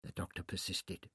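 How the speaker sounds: plain reading voice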